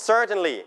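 "Certainly" stressed on CER; stress on first syllable. Shown as a demonstration. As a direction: hit the first syllable of "Certainly", then drop. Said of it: "พยางค์แรก". (เน้นพยางค์แรกของ "Certainly")

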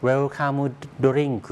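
"Welcome Drink" as Japanese werukamu dorinku; Japanese pronunciation. Shown as a demonstration. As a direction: say "Welcome Drink" Japanese-style, with added vowels. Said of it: "ภาษาญี่ปุ่นก็จะออกเสียงเป็น". (ออกเสียงแบบญี่ปุ่น เวรุคามุ โดริงกุ)

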